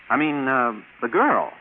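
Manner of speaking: ordinary talk, nothing marked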